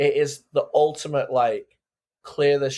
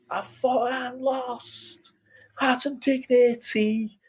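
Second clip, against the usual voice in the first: sung high in a hammed-up Scouse accent, slow; mock Scouse accent; 11 semitones higher, slower than usual. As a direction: belt the lyric like a Manchester-area singer in Scouse. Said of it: "That's my Scouse accent".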